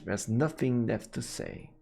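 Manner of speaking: ordinary talk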